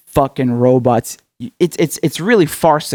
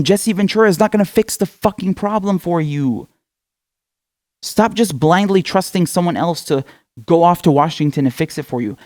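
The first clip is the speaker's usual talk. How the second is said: ordinary talk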